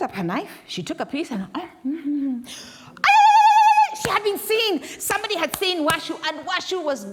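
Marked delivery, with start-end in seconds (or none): 3.0-4.0 a piercing high shriek of alarm; shocked scream. Acted. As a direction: shriek as if caught red-handed. She has been seen.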